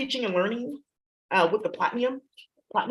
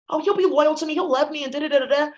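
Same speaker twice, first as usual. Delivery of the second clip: higher-pitched mock lovestruck voice; mocking imitation; 4 semitones higher, 4 dB louder than usual. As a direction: parrot it mockingly, trailing into da-da-da. Mocks someone's naive trust in a man.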